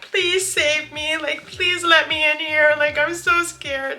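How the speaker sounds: high, drawn-out begging for rescue; retold tearful plea. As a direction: reenact a frightened, near-crying plea. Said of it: "I was literally crying".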